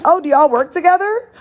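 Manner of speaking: high, incredulous imitation; mock disbelief. The cousin, doubting the two could be friends, high mock disbelief. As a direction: squeak it out in disbelief. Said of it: "even her own cousins is like".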